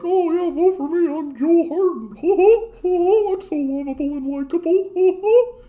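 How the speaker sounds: high, sugary mock politician voice; sing-song sweet talk like a smarmy campaigner; hammed-up lovable candidate with laughs; mock sappy candidate. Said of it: "will be like".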